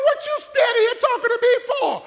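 high, loud, laughing voice of God; God, amused and impatient. Retold or imitated. God scolding Moses at the sea, high and loud. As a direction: tease Moses like an exasperated boss. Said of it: "He said".

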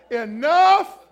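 firm loud declaration of enough. Shown as a demonstration. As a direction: plant your feet and shout it out. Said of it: "when you say".

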